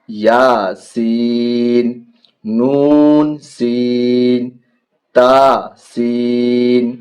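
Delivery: each letter name stretched long and sung out; slow letter drill. Shown as a demonstration. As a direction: chant the alphabet slowly for children. Drawn-out letter recitation drill.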